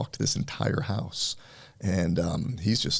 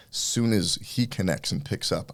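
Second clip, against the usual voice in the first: normal talking voice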